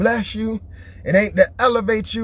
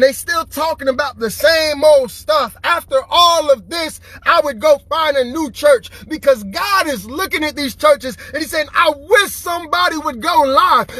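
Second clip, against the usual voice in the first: preaching; 6 semitones higher than usual. Acted. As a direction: preach it hard to the crowd. Preaching at full force.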